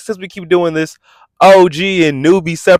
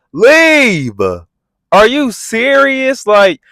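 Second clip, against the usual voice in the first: ordinary talk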